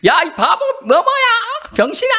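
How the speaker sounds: high-pitched pretend insult yell; hammed-up name-calling hollered into the hills; mock mountain shouting. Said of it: "산에 가서 소리쳤잖아요". (산에서 욕하며 외치는 흉내)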